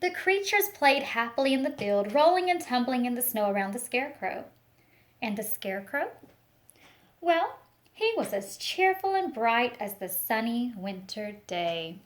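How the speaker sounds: put-on expressive picture-book reading for children; storytelling read-aloud. Teacher reading a picture book to the class, storytelling voice. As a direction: paint the story for a class of little ones.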